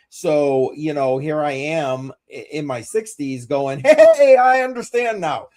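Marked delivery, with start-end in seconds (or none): 3.8-5.5 high, loud mock eureka; mock excited realization. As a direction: sing out a late discovery. Mock eureka of sixties self.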